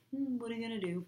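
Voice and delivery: high, sassy shrug of a line; sassy snake. Retold or imitated. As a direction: toss it off with sass. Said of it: "Being all sassy".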